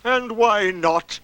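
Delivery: slow, drawn-out challenge; a touch of icy offence; coldly. Acted. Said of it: "said Eeyore coldly".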